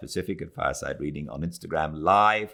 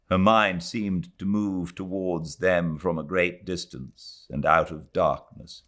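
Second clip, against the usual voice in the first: usual speaking voice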